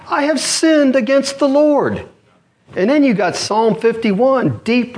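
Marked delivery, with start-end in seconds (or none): 0.1-2.0 high, slow plea of confession; crying out in repentance. Retold or imitated. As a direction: cry it out to God, slowly. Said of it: "David cried out".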